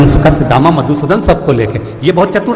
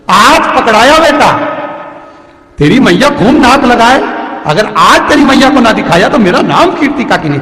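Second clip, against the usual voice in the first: put-on old aunt shouting up at the boy; triumphant scolding aunt; 6 semitones higher than usual. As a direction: catch the thief and crow over it. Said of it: "काकी आ गई धड़धड़ाती हुई".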